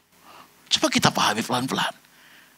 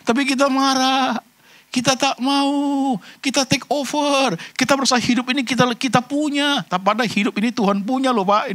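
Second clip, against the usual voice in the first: emphatic preaching; 13 semitones higher than usual. Acted. Fervent slow emphatic preaching. (khotbah tegas, tinggi dan lambat)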